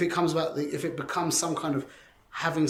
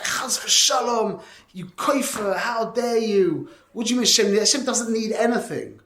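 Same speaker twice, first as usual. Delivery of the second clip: mock outrage; 6 semitones higher, 4 dB louder than usual. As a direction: imitate scandalized objectors, higher, building louder. Outraged crowd answering a controversial claim.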